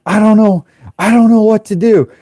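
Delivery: high, helpless mock wail; acted panic. Driver with a useless radio, panicking.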